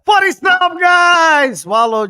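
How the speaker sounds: shouting. Real, not acted. Host opening the show, shouting.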